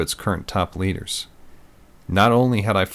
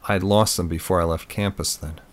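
ordinary delivery

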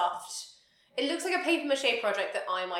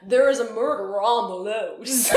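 a mocking, slightly louder fake Southern delivery, with a laugh; mock Southern detective line, laughing; imitating his odd Southern accent; 5 dB louder than usual. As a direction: lampoon the actor's half-Southern line reading. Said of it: "that's actually what he sounds like".

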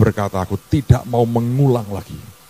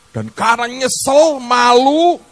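anguished cry; 12 semitones higher, 5 dB louder, slower than usual. Retold or imitated. Recalling shame before God.

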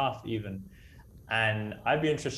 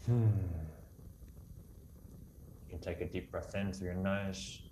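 guided meditation; 4 dB quieter than usual. Acted. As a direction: guide them gently into calm.

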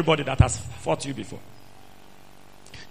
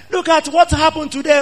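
usual speaking voice

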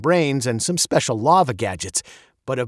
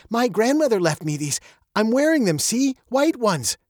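put-on higher, excited girl's voice; 10 semitones higher than usual. A narrator's reading. Girl showing off grandmother's pearls, mildly excited. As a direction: show them off with mild excitement. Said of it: "Veronica said excitedly, clutching her pearls".

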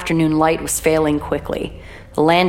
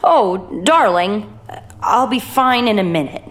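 slow, shaky, distressed; tremulous; 6 semitones higher than usual. Acted. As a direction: sound unwell and trembling. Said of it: "tremulous quality of her voice".

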